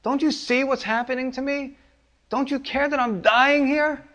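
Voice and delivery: high, anguished plea to the Lord; desperate prayer. Retold or imitated. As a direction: cry out to God as if drowning. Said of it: "and you say".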